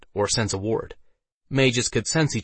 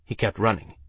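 ordinary delivery

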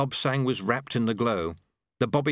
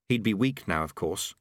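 ordinary delivery, nothing marked

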